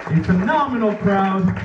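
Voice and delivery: high, rousing host call; crowd hype. Real, not acted. Comedian hypes up the audience. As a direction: pump up the crowd. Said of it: "hypes the crowd".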